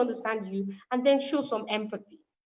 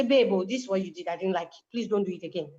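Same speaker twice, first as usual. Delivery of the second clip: gentle calm complaint; 7 semitones lower than usual. Retold or imitated. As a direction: tell your partner kindly what hurt you.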